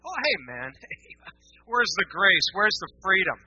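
sarcastic mock complaint at the church; mock outrage. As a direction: ham up the offended critic. Mocking critics' protest.

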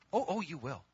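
ordinary delivery

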